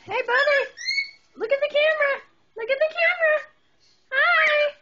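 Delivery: put-on high sing-song baby voice to a parrot; pet baby talk. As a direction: coax the bird like talking to a toddler. Coaxing a parrot toward camera.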